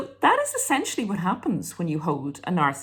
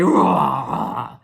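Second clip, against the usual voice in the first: short loud tantrum roar; hammed-up tantrum growl; mock rage roar; 8 dB louder than usual. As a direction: roar like an angry kid. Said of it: "rage at you and say".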